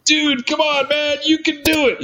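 very high, laughing-coach voice; laughing friend cheering from the top, very high; Dwayne coaching. Retold or imitated. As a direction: egg him on like a giddy coach. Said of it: "He's going".

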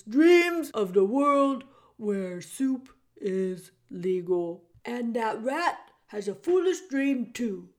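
put-on loud, booming old jailer who speaks of himself by name; the jailer Gregory greeting Meg in a big theatrical voice. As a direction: boom it out like a grand old jailer. Gregory muses on dreams.

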